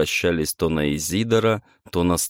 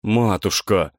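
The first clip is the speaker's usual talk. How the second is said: trembling voice; 3 semitones higher, slower than usual. A narrator's reading. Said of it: "дрожащим голосом".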